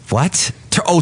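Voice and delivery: ordinary talk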